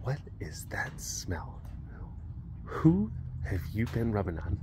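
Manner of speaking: slow suspicious whisper; jealous wife. Retold or imitated. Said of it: "And then she's just like".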